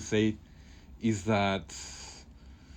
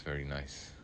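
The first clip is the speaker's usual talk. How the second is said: soft echo; 6 semitones lower, 11 dB quieter than usual. Real, not acted. Much softer echo of remark.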